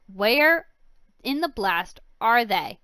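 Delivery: loud angry demand. Acted. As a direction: bark out the question. Villain searches for keys.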